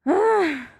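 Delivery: sharp, high, loud "Ah!" of dismay; sigh of dismay. Shown as a demonstration. Teacher shows the feeling aloud with a sharp high dismayed "Ah!". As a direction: cry out "Ah!" as if hurt by bad news. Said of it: "Bunu da göstererek".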